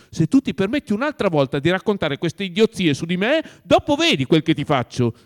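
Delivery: loud, high angry threat; heated threat from master to servant; threatening. A narrator's reading. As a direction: warn him hard, at full voice. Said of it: "aggiunse minaccioso".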